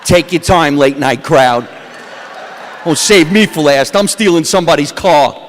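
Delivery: ordinary delivery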